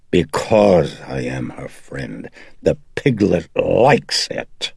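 slow, drawn-out demon voice; taunting demon. Acted. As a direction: relish each word as a taunt.

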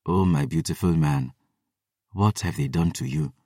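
low, hushed and tender dismay; soft, low, near-whispered grief; whispering. A narrator's reading. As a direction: murmur it softly to a wounded lover.

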